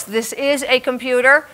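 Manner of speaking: ordinary delivery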